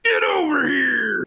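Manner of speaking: high straining battle shout; Scorpion voice. Retold or imitated. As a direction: scream it like a fighting game ninja. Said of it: "Scorpion's voice".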